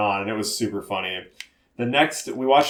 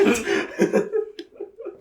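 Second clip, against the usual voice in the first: breathless squeaky laugh; helpless high wheeze of laughter; high squealing laugh. Real, not acted. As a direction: airless giggle far up. Laugh alone after joke.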